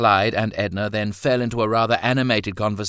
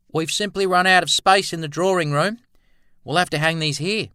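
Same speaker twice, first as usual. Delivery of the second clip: higher, louder breezy friend; Clyde's voice; 11 semitones higher, 6 dB louder, faster than usual. A narrator's reading. Clyde explaining the hung paintings to visitors, in a raised character voice. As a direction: speak up high and brisk as Clyde.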